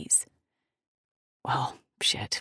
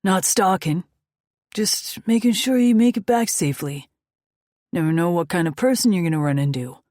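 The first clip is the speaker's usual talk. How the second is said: loud, higher reassuring biker; protective biker; 4 semitones higher, 9 dB louder than usual. Acted. Titan explaining to the woman he follows, loud and reassuring. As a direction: reassure her, easygoing.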